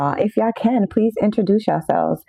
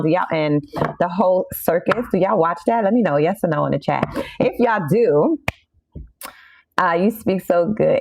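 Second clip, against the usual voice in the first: ordinary delivery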